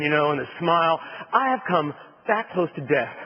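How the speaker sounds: ordinary talk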